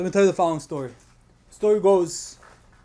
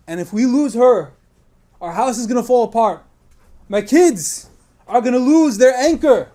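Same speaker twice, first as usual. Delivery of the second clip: desperate plea; 4 semitones higher, 5 dB louder than usual. Retold or imitated. Husband pleading with his Rebbe.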